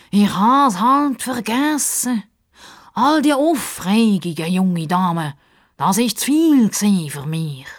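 high, slow, overblown excuse; self-excusing Justus. A narrator's reading. Sidekick excusing himself to the young lady, high and drawn out. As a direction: stretch the words out theatrically.

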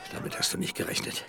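breathless whispered gloat. Acted. Viktor whispers over the river, gloating. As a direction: whisper it with triumph.

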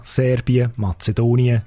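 ordinary talk, nothing marked

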